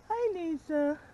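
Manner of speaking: high, sweet voice for a goat; sing-song pet greeting. Real, not acted. Greeting her baby goat in a high coo.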